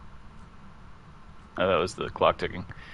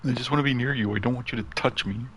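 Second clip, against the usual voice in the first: light, higher voice for a fussy cat; voicing the cat; 4 semitones higher, 4 dB quieter, faster than usual. The cat answering her owner, lightly voiced fussy cat. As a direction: speak as the cat who wants closeness without touch. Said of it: "she's, like, pulling herself away".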